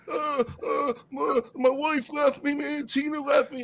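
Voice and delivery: high, whimpering mock sobs; mock crying. Heartbroken friend tells him his wife left, imitated high crying. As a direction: blubber through it like a dumped husband. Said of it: "why are you crying".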